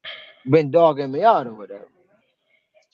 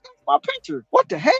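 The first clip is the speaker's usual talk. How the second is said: high, stunned cry at his own photo; sudden disbelief, voice jumping up; shocked; 12 semitones higher than usual. Retold or imitated. Seeing his picture in a news article, high and shocked. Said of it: "I said".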